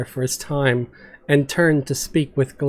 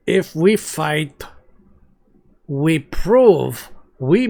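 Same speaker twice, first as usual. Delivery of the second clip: slow, grave monster paladin; solemn Glunk; slower than usual. Acted. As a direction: speak of dying for what is right, slowly. Glunk speaks calmly of martyrdom.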